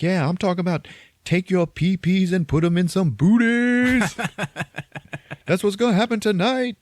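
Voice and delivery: hammed-up DJ rant; DJ hype. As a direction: hype it like a wild DJ. DJ hyping over microphone.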